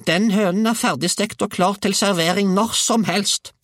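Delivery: put-on very high, loud old-woman voice; crowing grandmother. A narrator's reading. Grandmother declares burning hen ready to serve, high crow. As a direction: call it out in a high screech.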